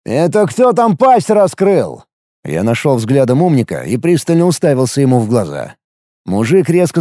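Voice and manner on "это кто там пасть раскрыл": slow, high, threatening growl; menacing challenge. A narrator's reading. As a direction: stare him down. He threatens the mocker.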